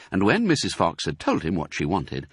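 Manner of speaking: plain reading voice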